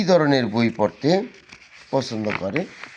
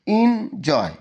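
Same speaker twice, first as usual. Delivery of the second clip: "Enjoy" split in two beats, the first high; broken into syllables; 7 semitones higher, much slower than usual. Shown as a demonstration. Teacher showing students how to break up big words, saying "enjoy" syllable by syllable. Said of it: "এটা দুইবারে উচ্চারণ হয়েছে".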